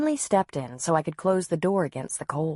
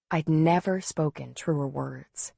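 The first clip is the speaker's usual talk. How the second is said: ordinary delivery, nothing marked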